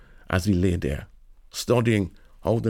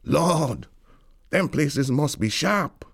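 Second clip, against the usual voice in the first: awed; 7 semitones higher, slower than usual. Retold or imitated. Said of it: "You say to yourself".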